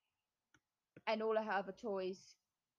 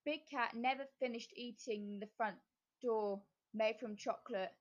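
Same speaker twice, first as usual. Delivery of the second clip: plain reading voice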